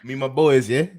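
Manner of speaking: put-on London roadman voice, playful; mock roadman accent. Teasing his friends as a London roadman, mock accent. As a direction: lean into the London slang, half laughing. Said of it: "We ain't doing the U K voices tonight".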